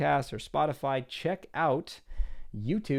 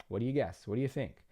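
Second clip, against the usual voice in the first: ordinary talk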